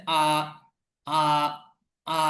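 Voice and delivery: "আ" as one open long vowel; R with silent r. Shown as a demonstration. Teacher shows the other R, r dropped. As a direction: leave "আ" open, no r at all. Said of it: "র এর উচ্চারণটা করার প্রয়োজন নেই".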